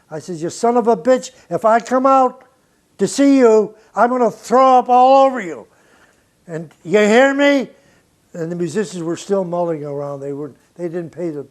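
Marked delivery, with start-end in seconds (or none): 0.4-7.7 loud, high angry yell; retold yelling. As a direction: bark it down the talkback. Shouting at Miles over talkback.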